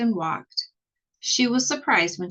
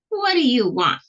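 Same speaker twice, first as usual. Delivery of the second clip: a mild, raised witchy demand; a hint of a cross witch; 6 semitones higher, 5 dB louder than usual. The witch calls from her window, mildly gruff. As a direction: bark it out like a grumpy witch.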